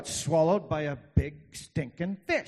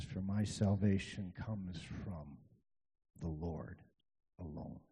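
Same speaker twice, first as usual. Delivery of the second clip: hushed scripture reading; 7 semitones lower, 9 dB quieter than usual. Acted. Solemn reading of Jonah's words.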